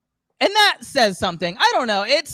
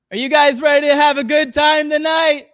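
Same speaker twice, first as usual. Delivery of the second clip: rock singer impression; 6 semitones higher than usual. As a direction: work the crowd like a rock star. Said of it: "This is my impression of a lead singer".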